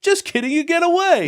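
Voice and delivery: squeaky teasing voice; playful high voice. Retold or imitated. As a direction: pitch way up, tease them. GM playfully voicing a tease.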